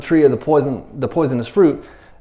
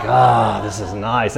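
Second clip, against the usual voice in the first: low, relaxed sigh of contentment; comfortable; 5 semitones lower, slower than usual. Retold or imitated. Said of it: "comfortable in it".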